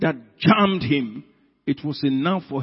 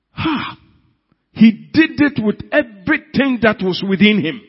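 full-force preacher, louder and higher; fiery preaching; 5 semitones higher, 7 dB louder than usual. Acted. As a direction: thunder it from the pulpit.

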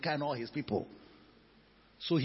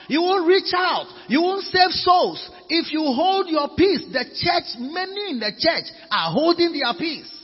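loud, high, forceful exhortation; slow, punched preacher's declarations; full-force preaching; 13 semitones higher, 8 dB louder than usual. Real, not acted. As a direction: cry it out to the congregation.

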